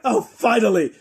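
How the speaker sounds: the long-waiting queen exclaims at last; relieved queen. Pretend. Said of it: "the Queen is just like".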